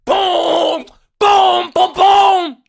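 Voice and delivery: high, loud chanted booms; hype shouts. Real, not acted. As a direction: shout each boom with the beat. Hyping along to the beat.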